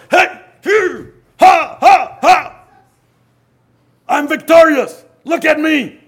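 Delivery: loud, high triumphant crowing; mock gloating. Preacher imagines risen Jesus showing off, loud mock gloating. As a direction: ham up a boastful victory lap.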